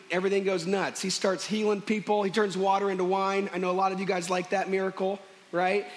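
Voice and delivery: ordinary talk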